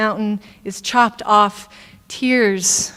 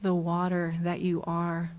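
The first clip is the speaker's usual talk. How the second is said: hushed, measured blessing; prayer; 3 semitones lower, 10 dB quieter than usual. Real, not acted. Leads a prayer.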